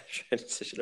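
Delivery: breathy amused laugh through words. Real, not acted. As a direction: laugh it out, teasing. Mocking S0's beer-and-pizza "training.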